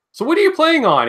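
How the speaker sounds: high-pitched, eager imitation of a curious question; excited mock question. Acting out excited message to student.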